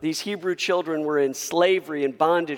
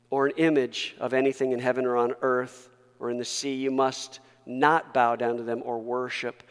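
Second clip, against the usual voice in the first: normal talking voice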